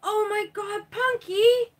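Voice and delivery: high, loud shriek of the cat's name; alarmed shout. Real, not acted. To her cat wrecking the painting, loud shocked shriek.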